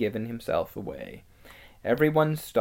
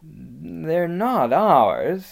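slow, drawn-out hesitation; dubious objection, raised and lingering; doubtful; 5 semitones higher, 6 dB louder, much slower than usual. A narrator's reading. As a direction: stretch the words out with misgiving. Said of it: "said Peter doubtfully".

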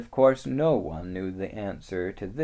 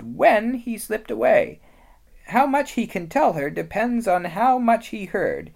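high, loud motherly beaver voice; lightly voiced anxious mother beaver; Missus Beaver; 11 semitones higher, 9 dB louder than usual. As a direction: scold the children urgently.

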